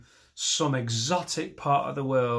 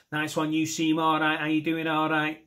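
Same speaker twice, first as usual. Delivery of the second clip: slightly raised one-note Mancunian patter; monotone Mancunian; 3 semitones higher than usual. Shown as a demonstration. Comic demonstrating a mate's greeting, monotone Mancunian. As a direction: hold the Mancunian monotone, evenly pulsed. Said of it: "Just hit the monitor".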